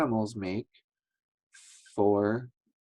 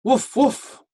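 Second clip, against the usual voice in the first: high, loud barking; dog bark; 13 semitones higher, 5 dB louder, slower than usual. Shown as a demonstration. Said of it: "A dog can go woof".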